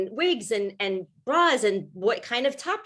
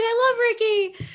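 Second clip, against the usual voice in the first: much higher, gushing imitation of mom; mom's gushing voice; 12 semitones higher, 5 dB louder than usual. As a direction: squeal it like an adoring mom. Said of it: "my mom's like".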